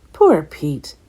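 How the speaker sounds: ordinary delivery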